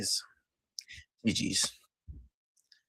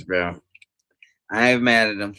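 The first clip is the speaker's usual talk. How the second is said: ordinary talk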